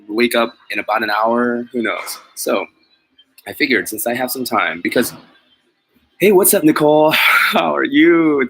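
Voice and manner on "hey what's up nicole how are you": bright, loud, higher hello; excited greeting. Real, not acted. Vlogger excitedly greeting viewer Nicole in chat. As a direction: greet her with a burst of energy.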